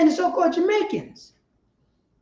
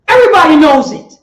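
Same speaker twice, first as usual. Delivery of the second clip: preaching louder; 9 dB louder, faster than usual. Acted.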